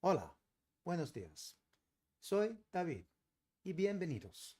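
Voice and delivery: put-on Spanish accent with falling endings. Shown as a demonstration. Streamer trying the Spanish accent on David, Spanish accent. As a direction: try out a Spanish accent, phrase by phrase. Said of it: "let's try the Spanish accent".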